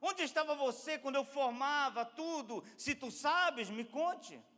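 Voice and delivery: high, loud interrogation; commanding challenge. Acted. God demanding answers from Job, commanding. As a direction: hurl the question with authority.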